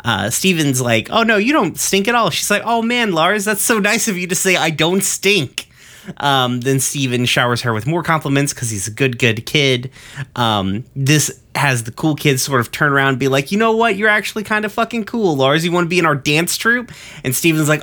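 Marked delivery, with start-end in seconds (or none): 1.1-2.3 higher-pitched mock reassurance; eager reassurance. Acting out Steven's reassuring compliment. 2.6-5.7 high-pitched imitation of gushing gratitude; touched thanks. Acting out Jenny's touched thanks. 13.5-16.9 high-pitched mock enthusiasm; won-over cool kid. Cool kids acted out, won over.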